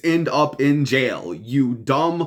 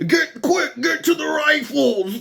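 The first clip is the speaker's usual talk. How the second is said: much higher, urgent mock battle cry; action movie hero. Host mocking the shooter as an action hero, high hammed-up yell. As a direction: ham it up like a movie soldier rallying troops. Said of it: "think they're in an action movie".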